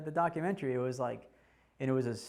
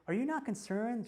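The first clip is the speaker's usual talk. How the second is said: news reporter; 8 semitones higher than usual. Retold or imitated. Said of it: "And she goes to me".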